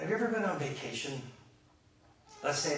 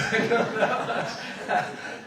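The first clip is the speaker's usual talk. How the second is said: loud, high burst of laughter; big real laugh; hearty laugh; 9 semitones higher, 11 dB louder than usual. Real, not acted. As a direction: crack up out loud. Laughing at an audience answer.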